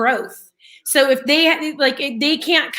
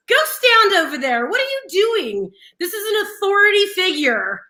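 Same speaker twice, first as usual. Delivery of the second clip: retold scolding; 6 semitones higher than usual. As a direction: scold a teenager like an exasperated mom. Retold scolding of friend's daughter.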